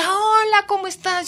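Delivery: high, loud friendly hello; effusive greeting. Retold or imitated. Stranger greeting the reporter, high effusive. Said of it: "alguien se me acerca".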